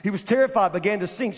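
fiery sermon delivery; preaching. Acted. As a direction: build the story with force. Preaching at full force.

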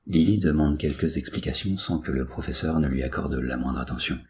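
plain reading voice

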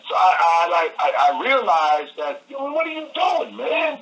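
pumping himself up, you're free; excited realization. Retold or imitated. Telling himself he's free.

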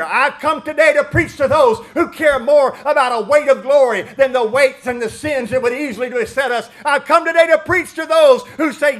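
loud, soaring preacher's cry; preaching at full force. Acted. Pastor preaching to the church, loud and soaring. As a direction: preach it hard to the congregation.

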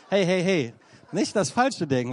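ordinary talk

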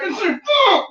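put-on high-pitched kid yelling back; retold defiant yell. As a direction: act out shouting back at a parent. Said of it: "they'd stop yelling".

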